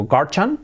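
guardian wrongly as GAR-chan; pronunciación incorrecta. Shown as a demonstration. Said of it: "Yo decía siempre". (guardian mal dicho, 'gar-chan')

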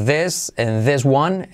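slow, careful teacher model; slow model phrase. Shown as a demonstration. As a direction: lay each word out slowly for learners. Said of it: "como decimos".

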